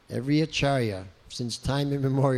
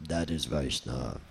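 much lower, grave pronouncement; Prabhupada's deep voice; 11 semitones lower, 6 dB quieter than usual. Retold or imitated. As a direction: drop the voice deep and solemn. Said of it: "In his deep voice".

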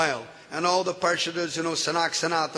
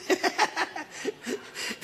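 airy laugh; 10 semitones higher, 5 dB quieter than usual. Real, not acted. Laughing at the boy's outburst.

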